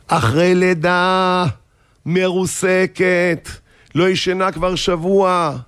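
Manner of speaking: high, slow, drawn-out appeal; slow admonishing plea. Acted. Angel scolding husband for his wife, slow pleading. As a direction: stretch each phrase to make him feel guilty.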